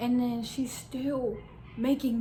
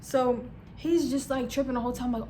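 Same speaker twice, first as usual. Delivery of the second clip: normal talking voice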